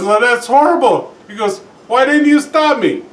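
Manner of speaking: a hammed-up cry of how could you; mock dismay. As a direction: play up Paddy's outraged shock. Paddy learns he jumped out window.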